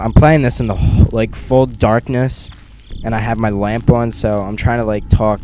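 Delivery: normal talking voice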